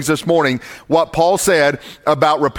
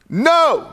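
No jumps much higher, hit hard; emphatic no; 10 semitones higher than usual. Shown as a demonstration. Preacher isolating the Greek No for his church, emphatic. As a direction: punch the word No alone. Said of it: "it is an emphatic no in the Greek".

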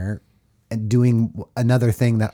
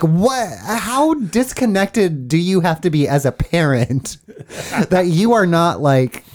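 higher, disbelieving mock outrage; incredulous; 7 semitones higher than usual. Reacting to the oblivious mom, higher disbelieving voice. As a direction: sell the disbelief at the parent. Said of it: "I'm like".

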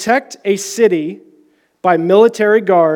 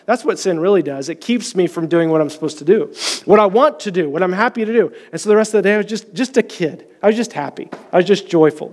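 normal talking voice

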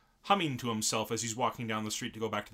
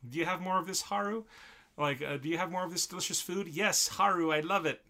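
high, pleading cartoon cat; a food-crazed cat begging its owner for more; eager cat voice; 6 semitones higher than usual. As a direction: beg for more like a greedy kitten. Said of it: "And he's like".

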